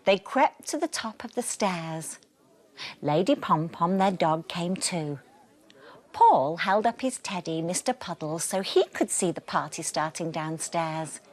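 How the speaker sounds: lively storybook reading with voiced characters; animated read-aloud for children; bedtime story narration. A narrator's reading. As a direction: read it like a bedtime book to little ones. Reading picture book to children.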